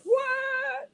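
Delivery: very high, drawn-out mock "what"; shocked outcry. Retailers reacting to the big announcement, mock shock. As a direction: squeal it in exaggerated disbelief.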